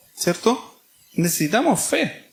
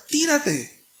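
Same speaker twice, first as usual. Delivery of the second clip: high-pitched urgent call, much higher; a father urging his son to jump; mock shout of jump, pitched high; 10 semitones higher than usual.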